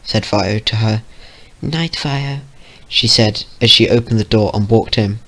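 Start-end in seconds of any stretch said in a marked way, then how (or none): 1.6-2.4 high, soft little pony voice; small, timid high-pitched goodnight; Fluttershy. Acted.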